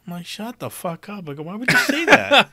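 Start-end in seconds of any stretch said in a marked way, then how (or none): 0.3-1.3 high, drawn-out mock exasperation; retold fed-up comeback; exasperated retort. 1.3-2.5 loud, high protest breaking into laughter; retold disbelief, cracking up; laughing incredulity.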